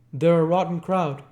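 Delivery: high, loud call across a lawn; shouting across lawn. A narrator's reading. Nick shouting to Gatsby across the lawn, loud. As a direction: shout it out over the grass. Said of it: "I shouted across the lawn".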